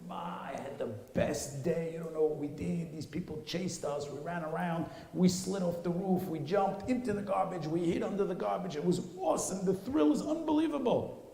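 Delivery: put-on a boy gushing over his best day; excited kid. Boy rushing home to tell his mother about the dump, thrilled.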